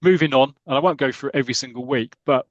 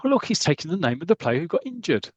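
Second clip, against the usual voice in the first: higher, amazed voice; amazed onlooker; 5 semitones higher than usual. Retold or imitated. Sir James and Seeley watching the stretcher, amazed. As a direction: point it out in astonishment. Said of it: "they're going".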